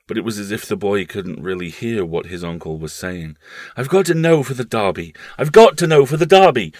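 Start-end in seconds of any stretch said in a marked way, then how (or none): 3.8-5.1 put-on raised, intent boyish voice; a boy fixed on the race; urgent boy. Paul to his uncle, fixed on the Derby, urgent. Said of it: "the child reiterated". 5.4-6.7 much louder, higher, near-mad insistence; frantic repeat. Acted. Paul repeating himself to his uncle, louder and frantic. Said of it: "the child reiterated".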